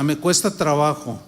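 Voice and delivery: ordinary talk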